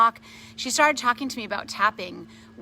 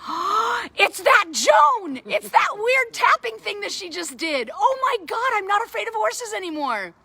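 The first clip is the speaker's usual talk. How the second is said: excited realization; 12 semitones higher, 5 dB louder than usual. Retold or imitated. Sudden realization retold, gasp before.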